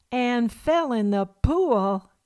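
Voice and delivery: drawn-out puzzled Southern question; Southern accent. Retold or imitated. Ma mishears Pa again, slow, in a mock Southern accent. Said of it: "in their strongest Southern accent".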